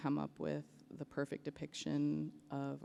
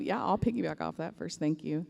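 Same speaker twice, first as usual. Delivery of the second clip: losing voice; 8 dB louder than usual. Real, not acted. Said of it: "I'm losing my voice".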